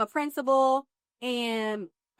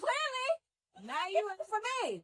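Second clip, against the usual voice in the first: mock dismay; 11 semitones higher, 4 dB quieter than usual. Mock outrage at preacher.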